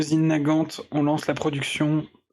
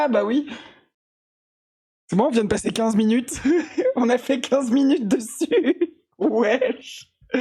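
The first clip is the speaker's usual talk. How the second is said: high laughter breaking through words; laughing; 8 semitones higher, slower than usual. Real, not acted. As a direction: laugh while talking. Laughs at wasted fifteen minutes.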